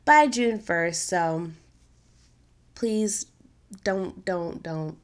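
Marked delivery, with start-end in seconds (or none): none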